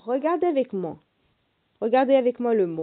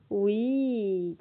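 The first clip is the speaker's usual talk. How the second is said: normal talking voice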